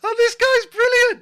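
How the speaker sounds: high, loud, laughing delight; real gleeful laughing squeal. Real, not acted. Delighted outburst at the act.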